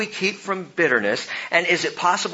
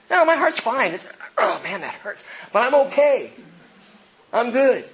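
mock denial; 7 semitones higher than usual. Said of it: "you say".

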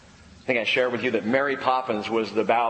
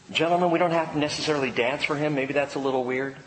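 normal talking voice

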